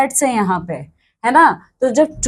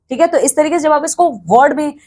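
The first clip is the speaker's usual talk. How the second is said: ordinary talk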